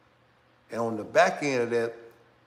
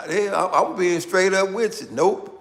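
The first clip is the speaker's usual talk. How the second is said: laughing; 6 semitones higher, 8 dB louder than usual. Real, not acted. Laughing, insisting on honest answer.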